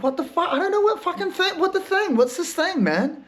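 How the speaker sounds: high-pitched baffled outburst; mock baffled squeal; exasperated. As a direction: squeak it out, totally lost. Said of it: "I'm like".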